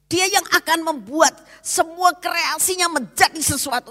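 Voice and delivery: building from high slow praise to shouted proclamation; preaching at full force. Acted. Proclaims God's attributes.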